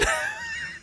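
squeaky burst of laughter; high squeal laugh. Real, not acted. Laughs after quoting line.

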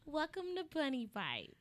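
put-on soft baby voice. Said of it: "Close us with it".

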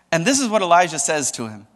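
ordinary talk

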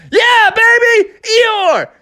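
Froggy hyped, shouting to his receiver; Froggy cheering. Acted. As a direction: celebrate the touchdown. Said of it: "And Froggy said".

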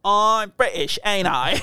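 jokey British put-on; British accent. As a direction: echo a silly mock British voice. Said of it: "British accent sometimes".

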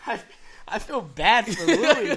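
loud, high, laughing through the words; can barely say it for laughing. Real, not acted. Cracking up over the name.

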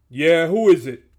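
aggressively indifferent. A narrator's reading. Guard answering a knock from behind the door, aggressively indifferent. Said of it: "said an aggressively indifferent voice".

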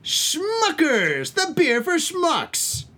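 high, slow, drawn-out announcer voice; hammy commercial tagline read; mock ad slogan. Host pitching a gag beer, mock-announcer voice.

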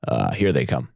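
dropped low and muttered. Acted. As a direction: sink down to a gruff low register. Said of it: "My narrator voice is also dropping down".

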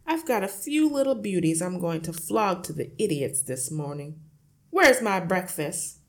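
loud, booming, boastful father; noisy, self-satisfied bluster; hearty shout. A narrator's reading. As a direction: shout it out like a cocky man of the house. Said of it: "slapped his son on the back and shouted".

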